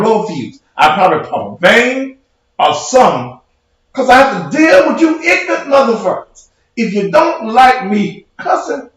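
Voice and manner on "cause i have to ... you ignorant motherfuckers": shouting it out in real anger; raised, exasperated yell; angry shout. Real, not acted. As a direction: let it rip at the commenters. Ranting at ignorant commenters.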